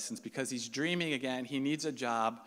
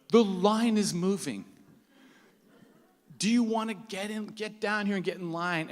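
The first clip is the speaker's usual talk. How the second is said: urgent call; 6 semitones higher, 5 dB louder than usual. Retold or imitated. Friend calls urging him to come.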